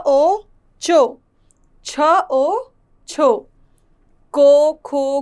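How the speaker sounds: letter, then ओ, then the blend, as in को; o vowel drill. Shown as a demonstration. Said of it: "सब में ओ का उच्चारण होता है". (ओ की मात्रा का उच्चारण)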